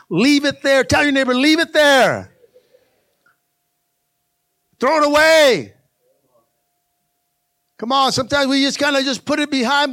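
loud, high preaching build; full-force preaching. Acted. Pastor rousing the church to leave the past behind.

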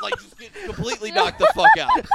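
high, loud words through real laughter; can barely get it out for laughing; cracking up mid-sentence; laughing hard. Real, not acted. Teller cracks up at the knockout.

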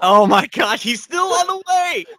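sudden high, loud outburst of surprise; excited shout. Real, not acted. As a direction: blurt it out, thrilled and shocked. Surprise at the news of the debut.

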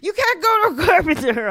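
loud, high, indignant doctor voice; stern doctor. Retold or imitated. Doctor snapping back loudly at the joking patient. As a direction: bark it like an outraged surgeon. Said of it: "He had no sense of humor".

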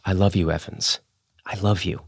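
soft, hushed declaration of love; whispered. A narrator's reading. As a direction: breathe it close to her ear. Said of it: "had whispered".

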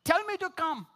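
high-pitched retold call; mild yell, high and urgent. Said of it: "He yelled out".

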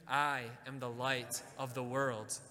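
slow, deliberate declaration; slow affirmation. Shown as a demonstration. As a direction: lay out each word for them to repeat. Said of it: "Can you say that with me?".

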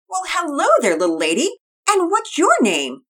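a mother cooing at a new dog; Mama Peters. Acted.

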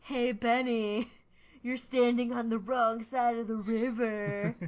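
slow, drawn-out mock call across a river; quoted movie taunt. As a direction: imitate a taunting film line, stretched out. Iconic film line, quoted theatrically.

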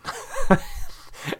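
breathy high-pitched laugh. Real, not acted. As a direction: burst into a high giggle. Laughing at own trip story.

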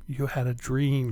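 soft, slow, drawn-out reassurance; soothing dismissal. Retold or imitated. Husband hushing a startled wife awake, soothing dismissal.

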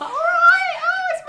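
high, loud hammed-up Essex; full Essex. Speaker mocking their own voicemail, full Essex. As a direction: holler it in broad Essex Cockney. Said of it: "You go full Essex".

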